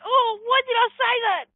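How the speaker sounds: very high, self-reproaching mock lament; regretful wail. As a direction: wail in dismay at your own slip. Regrets blurting it out, retold.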